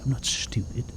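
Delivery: ordinary delivery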